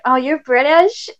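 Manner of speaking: high, drawn-out wonder; mock amazement. Imitating Americans on meeting a Brit, mock amazement. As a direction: imitate an awestruck stranger, stretching it out. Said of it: "everyone's like".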